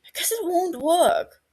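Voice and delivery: high, pressed insistence; argue. A narrator's reading. Regulus arguing with Barty that it won't work. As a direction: argue it back hard. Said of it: "Regulus argues again".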